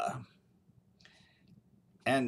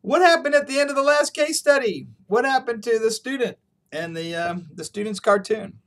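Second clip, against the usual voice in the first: imitating curious students, higher; students asking; 7 semitones higher than usual. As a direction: students pressing the professor after class, higher. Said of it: "and they said".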